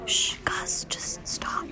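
soft whispered telling-off; whispered shushing. Pretend. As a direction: hush them in an ASMR whisper. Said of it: "let's just do some A S M R talking".

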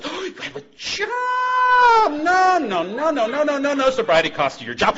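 very high, loud, mock-astonished voice; mock-surprised God. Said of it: "He says".

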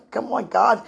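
ordinary talk, nothing marked

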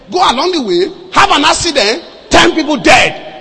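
loud, high, mounting alarm; forceful warning. Retold or imitated. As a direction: hammer out the disaster, building to a shout. Warning of a fatal bus accident.

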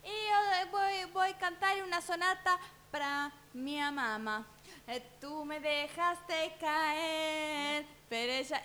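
put-on high, very slow little child singing out; little boy voice. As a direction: play the little son announcing his sonata. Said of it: "el más grandecito que dice". (voz aguda de nene chiquito, muy lenta)

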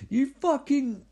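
high-pitched mock-furious scolding. Girlfriend scolds boyfriend for still filming, mock furious. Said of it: "she's furious".

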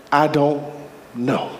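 low, halting, deliberate answer; unbothered reply. Acted. Reply to someone pressuring you, low and unbothered. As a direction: answer low, pausing before the last word.

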